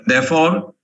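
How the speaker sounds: ordinary talk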